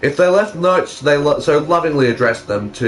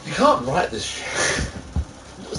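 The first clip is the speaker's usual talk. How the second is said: disbelief; 3 semitones higher, 8 dB quieter, much slower than usual. Real, not acted. Shocked reaction to fanfic.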